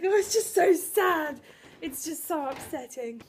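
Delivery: high, slow, drawn-out wail; retold blubbering. Answering a colleague who asked about crying, retold blubbering. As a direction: imitate own tearful wailing, hammed up. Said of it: "What the hell are you blubbering about?".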